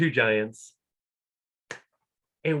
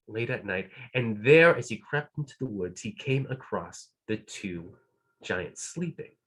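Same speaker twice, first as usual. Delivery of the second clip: usual speaking voice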